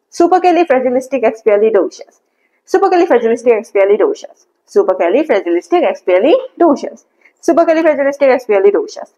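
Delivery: Supercalifragilisticexpialidocious run off at normal speed. Shown as a demonstration. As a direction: rattle the whole word out fluently. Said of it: "তারপরে এটা স্পিডে বলতে থাকবেন".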